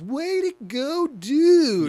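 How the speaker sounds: high, slow, mocking kid voice; Melvin voice. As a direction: sneer it out like a bratty kid. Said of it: "when Melvin goes".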